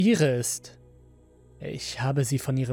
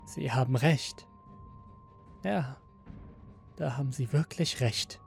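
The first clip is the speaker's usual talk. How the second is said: resigned agreement; 5 dB quieter than usual. A narrator's reading. Android conceding to the master, quiet and resigned. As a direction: give in quietly, with pauses. Android gives in before suicide.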